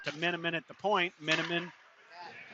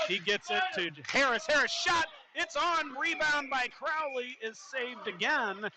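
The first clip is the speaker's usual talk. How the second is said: excited play-by-play; 9 semitones higher than usual. Real, not acted. Announcer calling a shot and rebound save, excited. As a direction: voice jumps high on the scoring chance. Scoring chance in live hockey call.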